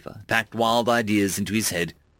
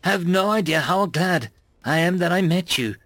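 earnest alien friend; 6 semitones higher than usual. A narrator's reading. Tarver's heartfelt confession.

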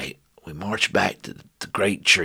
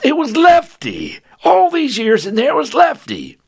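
astonished; 8 dB louder than usual. Acted. Narrator shocked finding Lefty.

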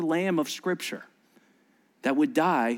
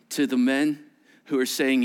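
normal talking voice

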